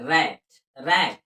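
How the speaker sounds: रैट drawn out with an open ae; रैट twice, slow and deliberate; slow model word. Shown as a demonstration. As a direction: repeat रैट slowly for the class. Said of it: "ए का उच्चारण ऐ हो रहा है".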